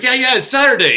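high, loud feigned obliviousness; playful teasing. Retold or imitated. Neighbor pretending to miss the birthday hint, playful teasing. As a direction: insist brightly, playing dumb to tease her. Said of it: "you can tell his voice, you know he's playing with her".

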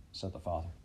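ordinary delivery

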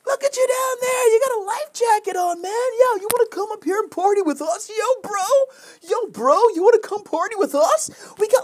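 very high, loud party-crowd shouting; calling down. Acted. Yacht partiers invite a stranded man up, calling down loudly. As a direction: holler down from the deck, high and excited. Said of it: "And they call down".

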